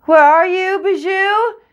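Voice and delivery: high, loud anxious call; frantic child calling. Acted. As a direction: shout for the dog across the orchard. Child calling for lost dog.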